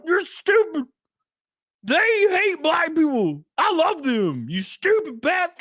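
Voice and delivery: high, pushy, ranting imitation; hammed-up condescending guy. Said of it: "he's like".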